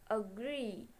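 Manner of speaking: "agree" with a marked a and a long gree; long vowel in agree. Shown as a demonstration. Teacher reading out the example agree, long final vowel. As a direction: draw out the end of "agree". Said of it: "The emphasis is on the word a, and the long vowels is in the word re".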